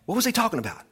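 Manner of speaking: ordinary talk, nothing marked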